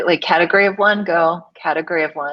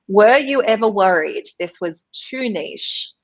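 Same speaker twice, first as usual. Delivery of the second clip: usual speaking voice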